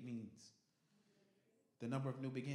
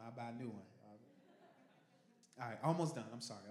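usual speaking voice

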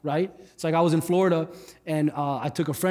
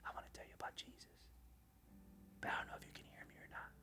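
hushed whisper, much softer; 7 semitones higher, 27 dB quieter, faster than usual. Retold or imitated. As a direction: whisper so the noisy kids lean in to hear. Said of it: "So here's what I did. I said".

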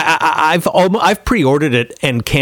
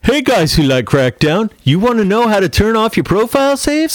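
YouTuber voice; 9 semitones higher than usual. Retold or imitated. Mock YouTuber intro.

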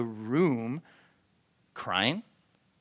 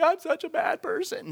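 high-pitched weepy wail; mock self-pity; 14 semitones higher than usual. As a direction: whine it like exaggerated tearful self-blame. Mimics tearful self-blame she doesn't feel.

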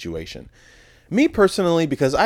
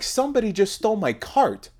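high, shrill-edged outraged customer; mock angry lady; 13 semitones higher than usual. Customer whose cart was stolen, imitated high outrage. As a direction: squeal it like a woman who lost her cart. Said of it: "she was mad".

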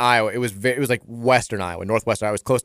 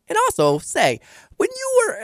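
put-on Fargo accent. Newsdesk coworker starting a question with "say", high Fargo accent. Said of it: "It sounds like Fargo".

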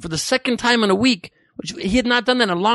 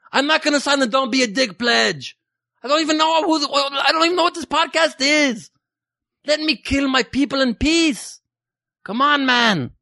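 put-on lightly Jamaican-accented Assad, higher and excited; 6 semitones higher than usual. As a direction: ham up a Jamaican dictator rallying his men. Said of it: "I don't know why I became Jamaican at the end".

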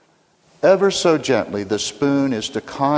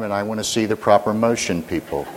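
ordinary talk

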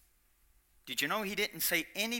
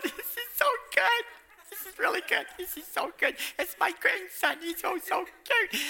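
much higher, cooing proud grandma voice; gushing grandparent; 13 semitones higher than usual. Retold or imitated. Proud grandparent at the preschool musical, gushing high. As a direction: coo over the grandson on stage. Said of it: "everybody's going".

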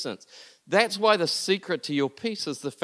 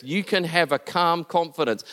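ordinary talk

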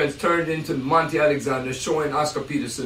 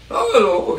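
higher, louder friendly greeting; 6 semitones higher, 6 dB louder than usual. Retold or imitated. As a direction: welcome him warmly. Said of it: "very friendly".

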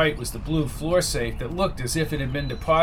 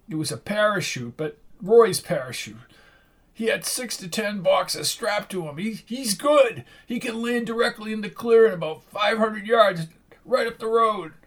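higher, nervous informant; nervous thug voice; 6 semitones higher than usual. A narrator's reading. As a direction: spill it out, uneasy. Jack's voice, higher.

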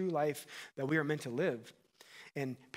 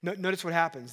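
ordinary talk, nothing marked

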